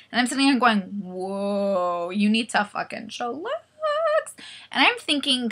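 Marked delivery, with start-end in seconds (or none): 1.0-3.5 mock whoa. Mock reaction to chattering women.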